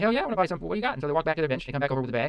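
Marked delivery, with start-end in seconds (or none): 0.0-0.9 higher, louder, eager yelp; eager excitement. Retold or imitated. Stoned friend jumps at the chance to buy cookies, excited. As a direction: jump at the offer like a kid.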